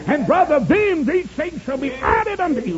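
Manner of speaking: high, loud revival preacher; high-pitched pulpit cry; full-force preaching. Acted.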